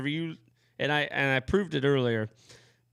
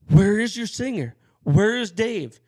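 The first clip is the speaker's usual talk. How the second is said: mock demanding; 7 semitones higher, 7 dB louder, slower than usual. Promoter quoted demanding.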